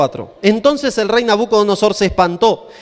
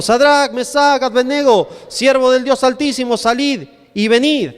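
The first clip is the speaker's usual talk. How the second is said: higher, drawn-out summons read aloud; calling out; 5 semitones higher, slower than usual. A narrator's reading. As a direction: summon the men out of the furnace. Reads king calling into furnace.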